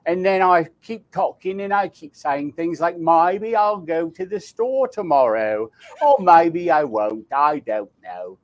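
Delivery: Cockney, with the voice placed forward in the mouth; Cockney accent. Shown as a demonstration. Actor demos his Cockney accent for the host, Cockney accent. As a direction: put on a Cockney accent for the crowd. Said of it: "If, if I put on the Cockney".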